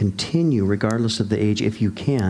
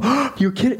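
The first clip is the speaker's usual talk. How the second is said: much higher, shocked "Oh, you're kidding"; alarmed parent; 14 semitones higher than usual. Retold or imitated. Anxious parent hearing a teen's news, shocked high reply. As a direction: squeak it out like a startled, anxious parent. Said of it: "you go".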